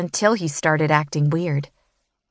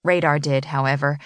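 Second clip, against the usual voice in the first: ordinary delivery, nothing marked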